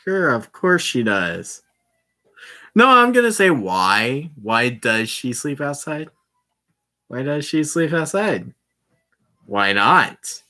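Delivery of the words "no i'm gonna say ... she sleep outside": ordinary talk, nothing marked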